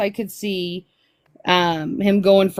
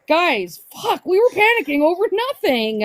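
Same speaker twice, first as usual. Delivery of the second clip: relieved friend voice; 9 semitones higher than usual. Retold or imitated.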